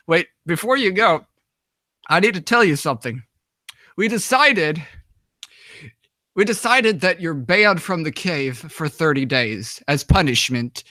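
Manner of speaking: lightly voiced official handing down a verdict; mock-solemn officer's pronouncement; imitating police officer. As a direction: announce the ruling with mock gravity, in measured pieces. Police officer delivers her ruling.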